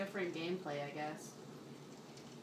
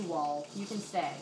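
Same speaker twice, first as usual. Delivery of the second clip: ordinary talk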